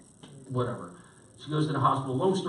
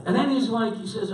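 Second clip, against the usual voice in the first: normal talking voice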